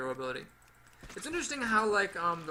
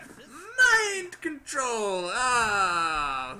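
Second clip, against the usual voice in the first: anguished cry; 6 semitones higher, 8 dB louder, much slower than usual. Retold or imitated. Lamenting losing to a card.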